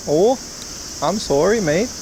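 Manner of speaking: rising, apologetic mock Canadian; a quick put-on Canadian sorry; playful Canadian accent impression. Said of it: "That was my Canadian accent".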